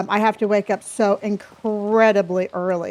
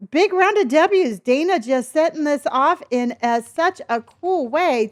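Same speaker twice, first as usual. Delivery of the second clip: ordinary talk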